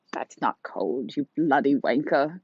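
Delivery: put-on slow, drawn-out British old lady; old woman British accent. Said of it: "That's my old woman British accent".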